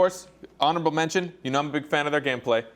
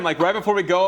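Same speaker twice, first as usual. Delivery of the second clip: usual speaking voice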